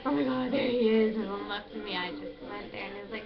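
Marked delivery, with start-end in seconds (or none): 0.1-1.2 gasp, then a loud excited burst; excited retold reaction. Vlogger retelling her thrill at seeing her date, excited. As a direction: act out breathless excitement at spotting someone. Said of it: "I was, like, so excited".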